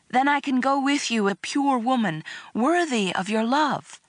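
high, overacted lovelorn pleading; begging. A narrator's reading. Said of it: "I begged him".

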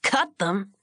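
a shocked echo of the words; stunned disbelief. A narrator's reading. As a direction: jaw dropping at the news. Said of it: "Bobby's jaw dropped".

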